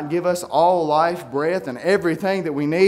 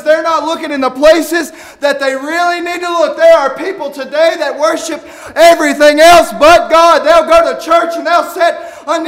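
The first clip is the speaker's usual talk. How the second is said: high, loud, fervent preacher's cry; fiery pulpit shout, slowing on the last line; preaching at full force; 8 semitones higher, 9 dB louder than usual. Acted. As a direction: shout it to the congregation like a revival preacher. Preaching peak.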